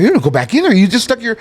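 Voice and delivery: high-pitched, mock-outraged objection; retold indignant protest. Retold protest to returning doctor.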